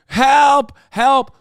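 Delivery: loud, high imitated cries for help; mock freaking out. Streamer imitating the woman freaking out, mock panic. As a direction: mimic her panicked yells, hammed-up. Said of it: "she's literally freaking out".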